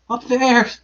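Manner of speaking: high little creature voice; Piglet. A narrator's reading. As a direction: squeak it urgently. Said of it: "said Piglet".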